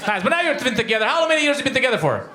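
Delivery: ordinary talk, nothing marked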